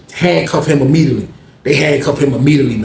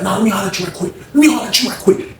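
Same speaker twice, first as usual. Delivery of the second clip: insistent call; 5 semitones higher than usual. Retold or imitated. Rollo insisting, calling roommate over.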